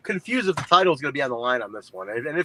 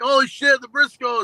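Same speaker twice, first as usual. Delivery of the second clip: excited; 10 semitones higher, 5 dB louder than usual. Retold or imitated. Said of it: "and I was like".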